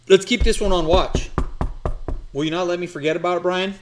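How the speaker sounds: loud, high and fast; excited shout. Real, not acted. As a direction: call it out to the room. Excited call-out to Brian.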